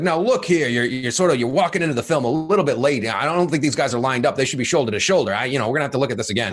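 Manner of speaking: mock coach picking apart the tape; Gruden. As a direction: lecture like a coach in film review. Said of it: "So he's like, he's like".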